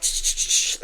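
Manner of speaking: quick hissed "shh" chops; karate-chop noises. Acted. Showing how she edits her videos, karate-chop noises. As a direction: imitate swift karate chops with sharp shushes. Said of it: "like, karate chopping".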